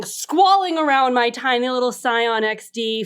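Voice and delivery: usual speaking voice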